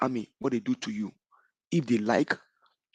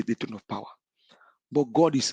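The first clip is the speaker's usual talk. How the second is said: whisper. Real, not acted. Preaching rant drops to hush.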